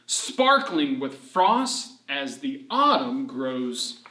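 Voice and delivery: slow, lilting poem read; gentle storybook rhyme read aloud. Acted. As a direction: read the verse slowly, like to children. Reads autumn alphabet poem.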